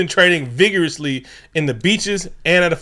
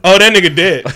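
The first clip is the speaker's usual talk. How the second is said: mock careless paramedic; 5 semitones higher, 8 dB louder than usual. Paramedic voiced mockingly.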